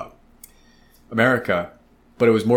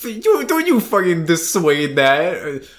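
mock indignation; 8 semitones higher, 3 dB louder, slower than usual. Mock indignant at his friend.

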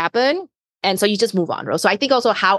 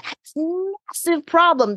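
big, raised voice on a massive problem; voice going up; 9 semitones higher than usual. Shown as a demonstration. Speaker showing how a big point sounds, raised and emphatic. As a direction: let the voice swell up on it. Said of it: "your voice goes up".

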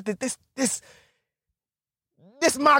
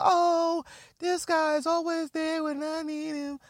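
much higher and softer, swooning; breathy dreamy girl voice; 7 semitones higher, 8 dB quieter than usual. Retold or imitated. Comedian mocking the naive woman's crush, high and soft. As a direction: sigh it like a starstruck girl. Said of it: "she's like".

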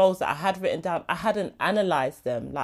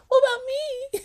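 much higher, half-laughing fake sob; mock crying whine. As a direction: whimper it like a self-pitying child, hammed-up. Jokes about her own jealousy.

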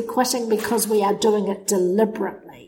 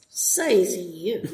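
put-on teenage snark, laughing. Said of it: "horrible teenage expression".

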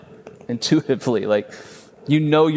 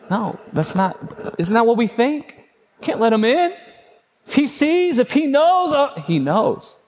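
much higher, frantic mock fear; mock panic; 11 semitones higher than usual. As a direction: panic about being seen. Mocking fearful thought.

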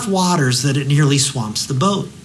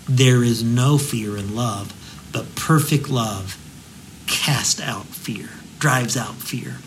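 slow, soft, lowered reading of the verse; almost whispering, let the words settle; tender scripture reading; 3 semitones lower, 5 dB quieter, slower than usual. Acted.

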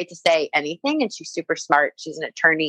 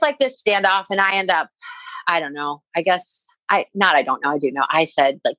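ordinary delivery

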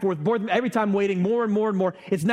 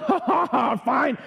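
cornered at the DMV counter, fake laughing; forced laugh; 5 semitones higher, much slower than usual. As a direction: laugh it off through clenched patience.

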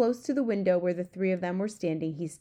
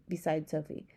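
ordinary delivery, nothing marked

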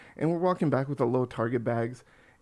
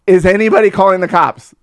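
loud, higher retold call-out; urgent appeal; 7 semitones higher, 14 dB louder than usual. Man holding the woman asks a bystander, loud appeal. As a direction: shout for someone to help.

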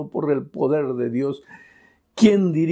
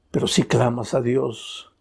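usual speaking voice

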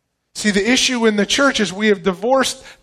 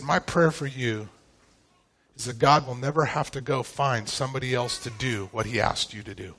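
solemn fatherly advice; 7 semitones lower, 8 dB quieter than usual. Retold or imitated. Father giving his son advice in a quote, solemn and hushed. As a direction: quote the prayer softly, sinking almost to nothing. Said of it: "it was a father talking to a son".

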